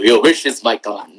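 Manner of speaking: deep genie voice; low and grand like a bowing genie. Retold or imitated. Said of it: "the genie says".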